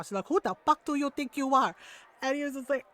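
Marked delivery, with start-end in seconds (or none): 0.3-1.7 imitated indignant mom with accent. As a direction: challenge him in a mom's scolding voice. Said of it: "like, the mom tone and everything".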